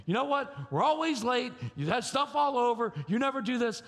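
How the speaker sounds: high-pitched frustrated parent rant. Acted. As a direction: mimic a parent nagging, exasperated. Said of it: "coming home all frustrated from work".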